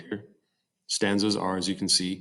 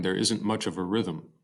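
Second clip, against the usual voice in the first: ordinary talk, nothing marked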